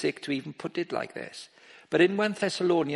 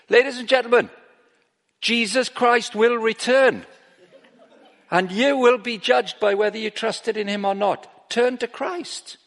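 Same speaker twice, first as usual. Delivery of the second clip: street preaching; 7 semitones higher, 7 dB louder than usual. Retold or imitated. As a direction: call it out like a street preacher halting the crowd. Street preacher addressing crowd.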